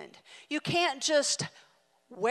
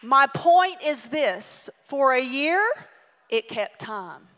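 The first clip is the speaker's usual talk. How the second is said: much louder, very slow; preaching emphasis; 8 dB louder, slower than usual. Real, not acted. Preacher turning to the lesson, loud and slow. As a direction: hammer the point home.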